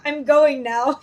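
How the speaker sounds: playful imitation of a blunt goodbye; abrupt announced exit. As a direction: blurt it out to end a conversation. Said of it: "I just wanna be like".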